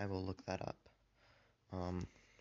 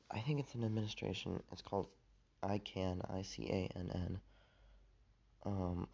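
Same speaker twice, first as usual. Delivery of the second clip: ordinary talk, nothing marked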